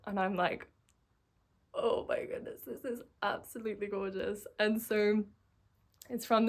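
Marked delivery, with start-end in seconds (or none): 1.7-4.5 breathy, near-whispered amazement; hushed awe. Retold or imitated. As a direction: drop to an awestruck half-whisper, much softer. Acting out her awed reaction.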